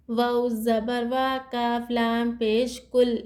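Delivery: slow, measured letter by letter spelling; slow spelling recitation. Shown as a demonstration. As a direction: spell each letter and vowel carefully. Spells out Quran letters slowly. (धीरे धीरे हिज्जे करके पढ़ना)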